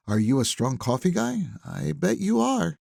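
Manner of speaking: a hint of a gracious hostess teasing; Delia, hostess. A narrator's reading. Delia offers coffee.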